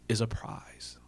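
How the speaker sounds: a near-whisper on the last words; soft emphasis. Real, not acted. Speaker to the panel, stressing the point in a hushed voice. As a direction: drop to a hushed, breathy close.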